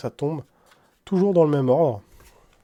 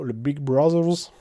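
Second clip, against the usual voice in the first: ordinary talk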